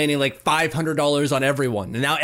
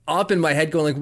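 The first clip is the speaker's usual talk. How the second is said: normal talking voice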